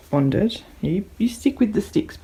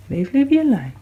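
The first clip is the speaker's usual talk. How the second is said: louder firm warning; telling off; 7 semitones higher, 6 dB louder than usual. Real, not acted. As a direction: warn the dog off. Telling dog off.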